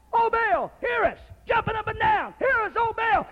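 high wailing plea; pleading Baal prophets. Retold or imitated. Prophets of Baal begging their god, high and wailing. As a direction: beg your god in vain. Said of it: "Them prophets of Baal crying".

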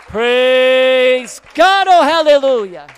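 loud, ringing hallelujah; loud praise. Real, not acted. Pastor exulting before the church, loud praise. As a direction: cry out in praise.

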